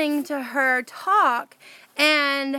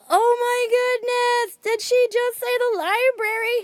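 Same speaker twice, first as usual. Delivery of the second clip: high, slow amazed gasp of disbelief; 10 semitones higher than usual. Retold or imitated. Narrator hearing the library mentioned after thinking of it all day, amazed. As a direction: pitch up in giddy disbelief. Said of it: "So I'm like".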